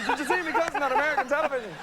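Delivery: put-on high, fast stage Irish accent, laughing through it; an Irish villager boasting again, laughing in stage Irish. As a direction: repeat the Irish brag with a laugh. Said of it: "people with my head wandering around going".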